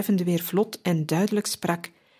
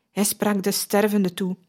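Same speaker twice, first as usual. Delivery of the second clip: ordinary delivery, nothing marked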